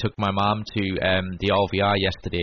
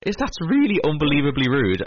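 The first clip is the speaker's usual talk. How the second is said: indignant retort; 6 semitones higher than usual. Retold or imitated. Retorting to a rude stranger.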